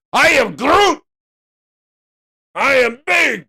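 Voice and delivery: high, booming Groot catchphrase; loud Groot. Acted. Trader hyping viewers to scale up, loud Groot. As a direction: shout it like a triumphant Groot.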